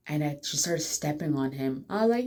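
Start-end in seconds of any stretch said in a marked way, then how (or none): none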